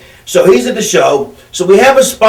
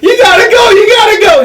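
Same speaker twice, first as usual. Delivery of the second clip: high, loud frantic yelling; frantic freakout; 4 dB louder than usual. Retold or imitated. Paulie screaming at the doctor to go out, high and frantic. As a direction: shriek it like he's losing it. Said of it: "Paulie's freaking out".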